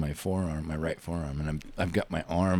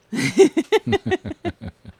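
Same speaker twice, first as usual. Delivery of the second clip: high laugh; 8 dB louder than usual. Real, not acted. Laugh after joke.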